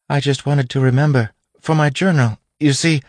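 nervous captive. A narrator's reading. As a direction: explain anxiously to the captor. Frightened captive explains herself.

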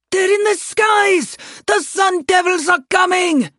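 high, loud panicked shout; frantic alarm cry. Acted. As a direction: yell the warning in terror. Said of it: "A panicked voice rose from Call's left".

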